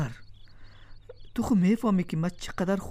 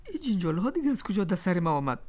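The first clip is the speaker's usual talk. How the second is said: usual speaking voice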